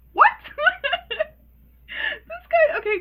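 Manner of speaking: loud, high words through laughter; real laughing. Real, not acted. Vlogger reacting to the creepy mechanic, laughing hard. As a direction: squeal it out while cracking up.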